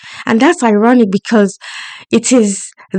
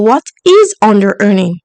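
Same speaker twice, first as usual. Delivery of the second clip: normal talking voice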